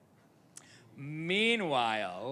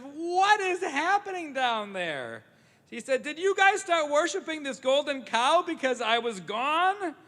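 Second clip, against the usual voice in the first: much higher and louder, shocked and angry; outraged Moses; 11 semitones higher, 6 dB louder than usual. Acted. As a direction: demand an answer from the crowd. Moses finds the golden calf.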